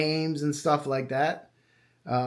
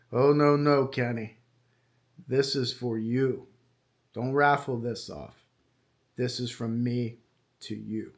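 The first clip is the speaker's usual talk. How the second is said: put-on a light, soft German accent, dropping lower and quieter; Doctor Warner Spitz's German accent; 6 semitones lower, 4 dB quieter than usual. Recalling the doctor refusing the raffle, in a soft German accent. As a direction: gently imitate a thick German accent. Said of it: "thick German accent".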